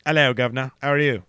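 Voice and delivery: hammed-up cockney-style English greeting; badly faked English accent; attempted English accent. Shown as a demonstration. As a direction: put on a cheesy English accent. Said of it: "Come on, in an English accent".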